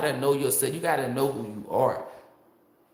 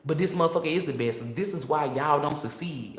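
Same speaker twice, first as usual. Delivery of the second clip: normal talking voice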